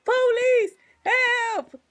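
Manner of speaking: high loud hammed-up cry for help; mock panicked plea. Mocking the girls calling the police, a hammed-up high cry for help. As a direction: scream for help like a fake victim.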